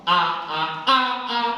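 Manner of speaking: loud "ah" climbing high; rising high-pitched voice drill on "ah"; high vocal exercise. Shown as a demonstration. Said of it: "Latihan tuh".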